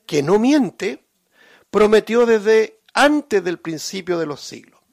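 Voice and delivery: preacher reading Titus aloud with force; scripture reading. Acted. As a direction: proclaim the verses to the congregation.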